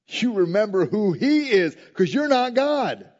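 loud, high, emphatic declaration; forceful preaching. Real, not acted. Preacher reminding the congregation they are not God, forceful and loud. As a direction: raise the voice and drive it home.

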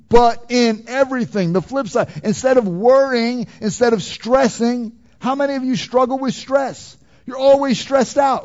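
high, loud preacher's delivery; forceful preaching. Real, not acted. Preacher asks a congregation about stress, forceful preaching.

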